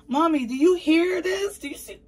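hammed-up exasperated plea; imitating her own whiny plea. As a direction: whine at mom, pitch way up. Quoting herself complaining to mom.

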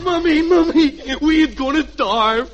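put-on little boy. Red as Junior calling to his mother, bratty kid voice. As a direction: play a bratty little boy tugging at mom. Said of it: "and I'll be the little boy".

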